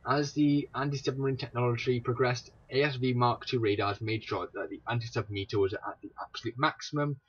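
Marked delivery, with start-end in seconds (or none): none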